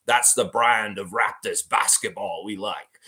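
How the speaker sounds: light imitation of an Australian accent on "That's the brand of Raptors basketball we like". Host quoting the Australian coach, light Australian accent. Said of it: "it sounds so cool with his accents".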